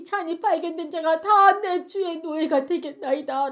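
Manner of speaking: high, imploring confession; acted beseeching, slowing on key phrases; desperate plea. Judah pleading before Joseph, acted out.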